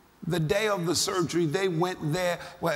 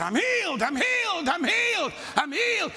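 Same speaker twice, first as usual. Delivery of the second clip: retold hospital-bed shouting; 11 semitones higher than usual. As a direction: shout each one out like you can't hold it in. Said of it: "I'm just shouting".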